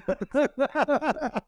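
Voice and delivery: high-pitched burst of laughter; long amused laugh. Real, not acted. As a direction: crack up helplessly. Both laugh at the bombing story.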